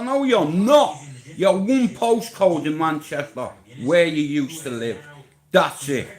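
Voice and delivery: loud, furious snap at the rival; angry shout. Real, not acted. Ranting at a rival fighter, angry shout. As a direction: yell it right in his face.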